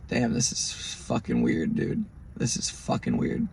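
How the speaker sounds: low, slow and soft; hushed, low retold unease; uneasy aside. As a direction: mutter it slowly under your breath. Retelling his unease at being followed.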